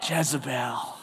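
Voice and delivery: low, hushed, menacing name; sinister Jezebel. Shown as a demonstration. Preacher names the wicked queen to congregation, sinister. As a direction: say the name low and quiet, like a villain's. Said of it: "you say Jezebel".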